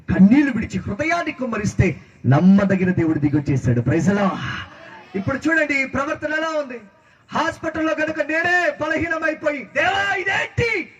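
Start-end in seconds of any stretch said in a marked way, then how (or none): 5.2-10.9 high-pitched pulpit cry; impassioned preaching. Real, not acted. Preacher voices a desperate plea. (ఆవేదనతో ఎత్తైన స్వరంలో ప్రసంగం)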